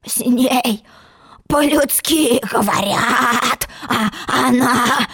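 slow, grumbling croak with stretched vowels; hoarse frog. Acted. Frog complaining that Alice won't understand, slow hoarse croak. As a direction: croak hoarsely and drag every word. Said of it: "хрипел лягушатище".

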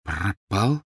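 halting question, rising at the end; deflated echo. A narrator's reading. Boy repeating the elf's word, deflated. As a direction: echo it as your hope drains. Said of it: "повторил Гарри, чувствуя, как сникает весь его восторг".

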